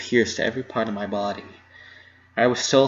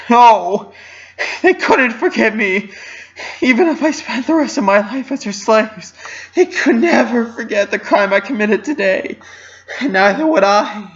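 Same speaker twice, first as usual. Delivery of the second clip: despairing sob; 9 semitones higher, 7 dB louder than usual. A narrator's reading. Despairing outburst while sobbing.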